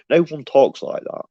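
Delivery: usual speaking voice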